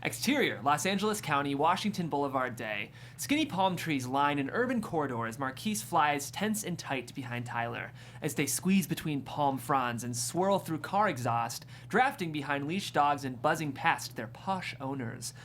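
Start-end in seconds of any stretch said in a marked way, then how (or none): none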